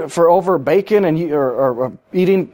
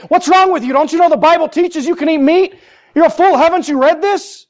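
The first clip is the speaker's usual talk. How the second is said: mock yelling; 9 semitones higher than usual. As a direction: play the angry know-it-all blasting a weak believer. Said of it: "start yelling at him, blasting him".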